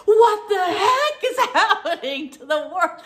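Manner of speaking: high, loud, laughing mock panic. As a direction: play the husband's freak-out while laughing. Husband freaking out, retold.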